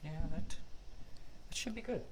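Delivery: normal talking voice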